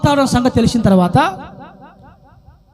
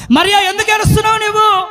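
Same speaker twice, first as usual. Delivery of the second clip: high, ringing call; Jesus; 6 semitones higher, faster than usual. Retold or imitated. Jesus asking Mary why she weeps, high ringing call. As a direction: call out the question in one quick raised sweep.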